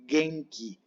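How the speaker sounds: "genki" as slow guen-ki; hard g as in gato opening "genki"; Japanese hard G. Shown as a demonstration. Teacher models word for estar sano, "genki" as guenki. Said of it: "se dice genki".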